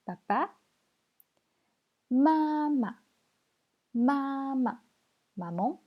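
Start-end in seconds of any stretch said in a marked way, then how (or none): 2.1-4.8 "Mama" high and level, then a short light syllable; neutral tone. Shown as a demonstration. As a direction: hold the first "Ma" high, let the second drop. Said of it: "on va voir le ton neutre".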